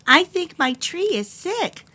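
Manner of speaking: high, anxious little cub voice; worried little bear. Acted. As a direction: fret aloud like a small cub.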